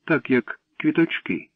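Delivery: mild, plaintive longing; sad. A narrator's reading. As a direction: plead gently in a girl's high voice. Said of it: "сумно запитала Катя".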